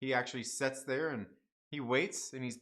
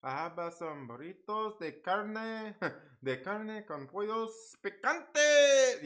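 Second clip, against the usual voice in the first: Spanish accent; 4 semitones higher, slower than usual. Shown as a demonstration. Streamer mocking his own Spanish attempt, in an exaggerated Spanish accent. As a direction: stretch out a mock Spanish accent to a big finish. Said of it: "say all that in a Spanish accent".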